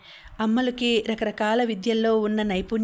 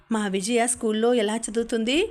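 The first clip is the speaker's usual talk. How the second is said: usual speaking voice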